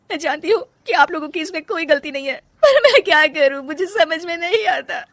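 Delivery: wife tearfully admitting her helplessness. Acted. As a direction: admit you're lost, fighting tears.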